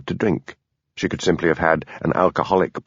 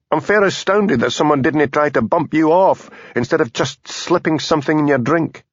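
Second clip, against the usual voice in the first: put-on annoyed Highland accent; 7 semitones higher than usual. A narrator's reading. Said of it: "the sudden sibilance of his accent showing he was annoyed".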